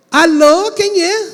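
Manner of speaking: high, loud anxious hello; high loud phone greeting from a worried mother. Retold or imitated. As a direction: call out like a worried mother answering.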